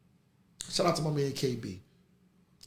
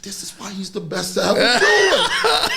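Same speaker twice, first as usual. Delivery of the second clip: laughing; 4 semitones higher, 9 dB louder than usual. Real, not acted. Laughing while praising his friend.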